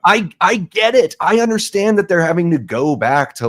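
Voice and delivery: high-pitched, impatient acknowledgement; exasperated concession. Retold or imitated. Acts out own exasperated reaction.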